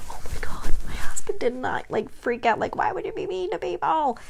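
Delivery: high, breathy disbelief, voice jumping up; incredulous aside. Retold or imitated. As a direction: squeak out your amazement. Marvelling thought, voiced aside.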